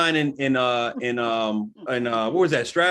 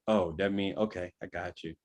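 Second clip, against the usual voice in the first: quiet mock realization; 12 semitones lower, 11 dB quieter than usual. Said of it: "I was like".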